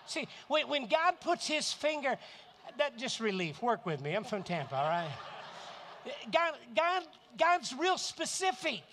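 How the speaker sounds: loud, high preaching build; fired-up pulpit shout; preaching at full force. Real, not acted. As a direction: hammer it home to the crowd.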